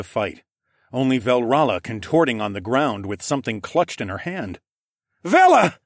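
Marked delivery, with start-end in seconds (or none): 5.2-5.8 high, very loud cry of alarm; screamed name. A narrator's reading. Bowden seeing Vel fallen on the ground, screaming. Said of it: "Bowden screamed".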